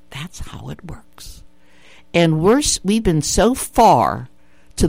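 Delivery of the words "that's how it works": repeats it in a near-whisper, slowly. Real, not acted. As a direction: drop to a knowing whisper.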